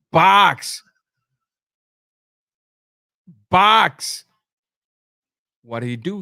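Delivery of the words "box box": corner yelling. Retold or imitated. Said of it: "telling him".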